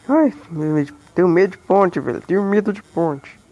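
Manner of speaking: low put-on voice, playfully afraid; mock scared voice. Gamer joking to friends about fearing the bridge, in a low put-on voice. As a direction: fake a silly fear of bridges.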